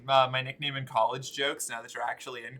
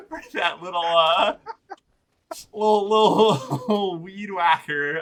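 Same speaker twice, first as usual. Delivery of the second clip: laughing; 6 semitones higher, 9 dB louder than usual. Real, not acted. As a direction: laugh helplessly through it. Laughing, struggling for words.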